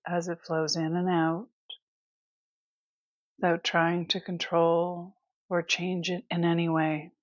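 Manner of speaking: guided meditation. Acted. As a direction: ease them through it with long, restful pauses. Leads breath awareness.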